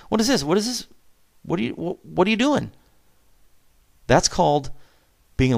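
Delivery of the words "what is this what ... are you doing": high, pointed parental scolding; a dad catching his daughter's texts, mock stern. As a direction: demand an answer from the kid, raised pitch. Dad confronting daughter over texts.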